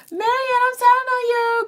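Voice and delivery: high, drawn-out mock tattle; singsong it like a teasing tattletale; mock telling-on-you squeal; playful tattling. Playfully threatens to tattle on Marianne.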